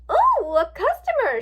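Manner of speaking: high, loud, drawn-out delight; gleeful. A narrator's reading. Rarity spotting someone in her shop, gleeful squeal. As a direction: squeal it with glee. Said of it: "she said gleefully".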